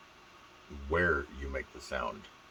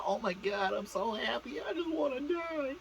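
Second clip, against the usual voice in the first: put-on squealing girly voice. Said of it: "and there's the".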